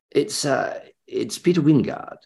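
hesitant honeyed self-introduction; Peter Wyngarde. Retold or imitated. Actor repeats who he is to doubting fan, honeyed. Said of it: "And, and this voice said".

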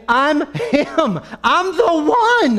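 high, loud shout; exasperated insistence. Acted. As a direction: shout to be believed, stretching the words. Blind man insisting on identity.

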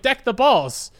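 eager blurt. Retold or imitated. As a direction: say it proudly for the teacher. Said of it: "I was like".

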